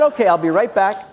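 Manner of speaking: said through a laugh. Real, not acted. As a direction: laugh while you say it.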